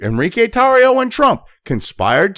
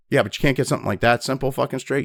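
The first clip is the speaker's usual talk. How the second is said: usual speaking voice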